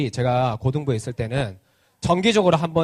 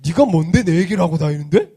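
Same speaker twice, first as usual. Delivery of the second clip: retold angry yell; 3 semitones higher, 5 dB louder than usual. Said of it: "소리를 지르는 거야".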